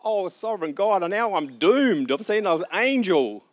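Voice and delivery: terrified cry to God; panicked cry. Acted. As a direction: wail it like a man who thinks he'll die. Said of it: "he cried out".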